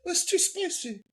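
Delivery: high, exaggerated British accent mimicking a delicate Englishwoman; bad British accent. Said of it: "worst British accents ever".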